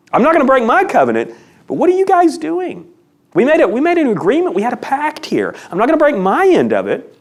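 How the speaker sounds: exasperated challenge. Acted. Preacher voicing God calling out Israel, exasperated.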